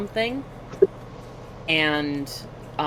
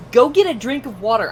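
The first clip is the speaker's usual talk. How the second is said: louder, bossy advice; bossy coworkers; 5 semitones higher, 7 dB louder, faster than usual. Retold or imitated. Coworkers telling her to drink water, bossy. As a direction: order them to drink water. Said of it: "they're like".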